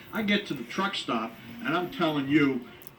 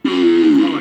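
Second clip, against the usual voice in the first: fart sound; 11 semitones lower, 13 dB louder than usual. Acted. Fart bit, groan of relief.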